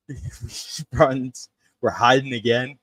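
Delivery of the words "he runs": ordinary talk, nothing marked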